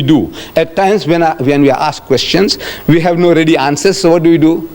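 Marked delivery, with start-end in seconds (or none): none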